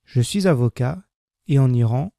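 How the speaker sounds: usual speaking voice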